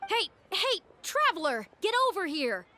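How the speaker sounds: high urgent call across the room. Acted. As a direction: hail him before he slips away. Calling the Traveler over.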